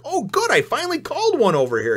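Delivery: thrilled at guessing right; excited. Retold or imitated. Reader cheering his own prediction, squealing high. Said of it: "I was like".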